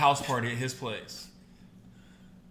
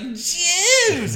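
much higher, loud hammed-up yell; 9 dB louder than usual. Imitating the wife shouting to the cheering crowd. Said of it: "'Cause then she's like".

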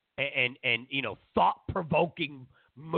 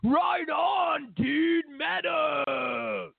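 hammed-up rocker chick cheer; mock rocker chick; 8 semitones higher, much slower than usual. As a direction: yell it like a stoked rocker chick. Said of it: "where rocker chicks like".

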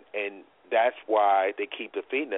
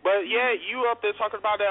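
pissed off; 9 semitones higher than usual. Real, not acted. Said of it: "this is what really pisses me off".

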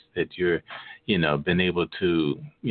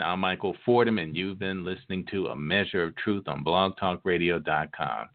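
ordinary delivery

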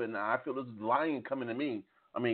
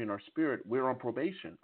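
normal talking voice

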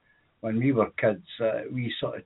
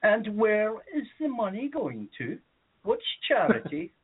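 quizzing homeowner; 10 semitones higher than usual. Retold or imitated. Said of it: "they would always say to you".

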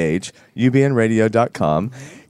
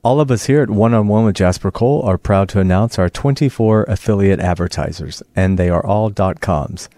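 ad read; 3 semitones lower than usual. Acted. Sponsor list read.

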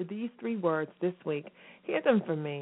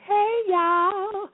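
high, louder bright call-out greeting; excited hey; 12 semitones higher, 5 dB louder than usual. Real, not acted. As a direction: shout a happy hello to the listeners. Excited greeting to new listeners.

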